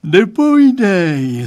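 loud, high, drawn-out yawn. A narrator's reading. Father replying to the boy, yawning. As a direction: stretch the word through a big yawn. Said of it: "zívnul pan Stránský".

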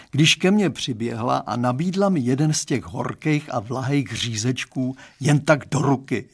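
usual speaking voice

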